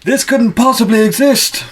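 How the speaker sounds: high, loud mock commentator scream; over-the-top wrestling announcer outburst. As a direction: yell it in hammed-up disbelief. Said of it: "We then get J B L screaming".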